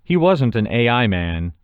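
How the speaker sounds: ordinary delivery, nothing marked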